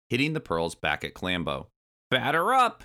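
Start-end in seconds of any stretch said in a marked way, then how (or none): 2.1-2.8 high, loud ballpark yell. A narrator's reading. Old monkey batting back pearls, high loud yell. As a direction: holler it like a ball player. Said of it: "yelled Cranky".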